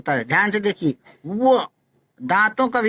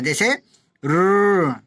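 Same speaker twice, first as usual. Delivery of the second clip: ordinary talk, nothing marked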